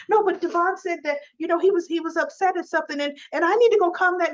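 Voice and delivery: put-on high, fast, anxious girlfriend voice; a flustered pitch-up as Abby; Abby's voice. Girlfriend wanting to break up the fight, high anxious voice.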